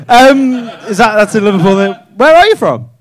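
ordinary delivery

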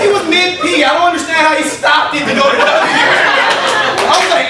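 ordinary delivery

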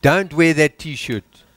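ordinary talk, nothing marked

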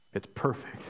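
a soft, hushed echo of perfect; a quiet, awed aside. Acted. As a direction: breathe the words almost to yourself. Echoing it softly.

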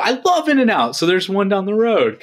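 high-pitched, excited burst; squealy fan enthusiasm; excited gushing. Real, not acted. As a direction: gush about it, voice shooting way up. Gushing about a favourite burger chain.

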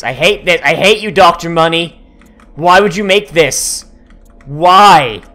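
loud angry shout; angry rant. Real, not acted. At Doctor Money, a loud angry rant. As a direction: rail at the maker in fury. Rants at game maker.

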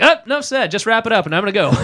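high, loud, laughing cut-off; mock eager dismissal. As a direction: cut them off brightly, pitched right up. Acting out his instant refusal of treatment.

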